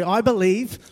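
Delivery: ordinary talk, nothing marked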